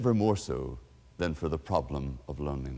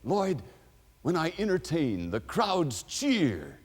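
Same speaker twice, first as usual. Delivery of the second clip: a higher, proud entertainer's voice, lightly played; boastful star; 10 semitones higher, slower than usual. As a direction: brag like a star about the cheering crowds. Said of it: "He said".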